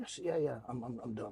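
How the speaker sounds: plain reading voice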